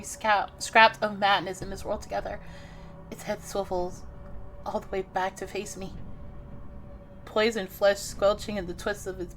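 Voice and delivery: soft, hushed reading of a creepy scene; horror story narration. Acted. Reads horror game narration.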